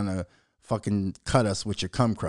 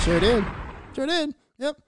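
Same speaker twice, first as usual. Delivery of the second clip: climbing up in pitch; each repeat pitched higher, sure did; rising higher each time. Shown as a demonstration. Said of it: "repeated rising each time".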